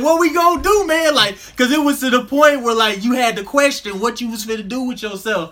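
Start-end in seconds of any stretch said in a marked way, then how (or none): 0.0-1.1 high, loud retold plea; mock helplessness. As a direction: act out a stoned friend at a loss, much higher and louder. Retelling stoned friend's helpless question.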